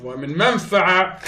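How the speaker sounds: ordinary talk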